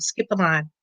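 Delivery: a quiet, settled restatement. Shown as a demonstration. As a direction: drop it down to let it sink in. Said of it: "I can't stress it enough".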